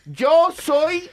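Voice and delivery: defiant mock-dramatic outburst; emphatic declaration. Real, not acted. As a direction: stand up for yourself loudly. Defending himself, heated banter.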